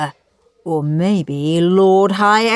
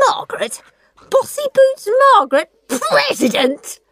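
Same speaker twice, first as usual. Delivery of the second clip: appalled disbelief. A narrator's reading. Henry appalled at nomination.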